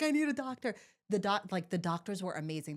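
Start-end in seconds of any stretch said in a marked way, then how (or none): 0.0-0.7 panicked husband. Retold or imitated. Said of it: "he was like".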